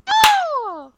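sudden high, loud yelp; real startled cry. Real, not acted. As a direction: jump at the count of three.

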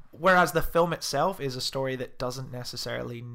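ordinary delivery

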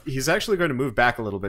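ordinary talk, nothing marked

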